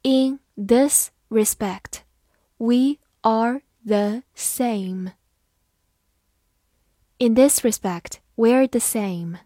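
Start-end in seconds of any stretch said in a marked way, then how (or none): 0.0-5.1 slow, careful read-aloud; slow classroom model reading. Shown as a demonstration. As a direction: read it slowly for the class to follow. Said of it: "好，慢慢来读。".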